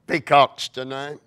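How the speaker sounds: ordinary talk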